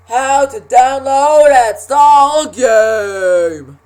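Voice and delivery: slow, booming title announcement; announcer voice. Acted. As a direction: call out the show title big and slow.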